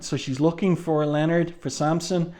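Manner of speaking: usual speaking voice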